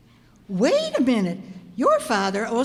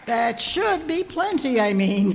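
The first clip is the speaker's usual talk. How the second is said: normal talking voice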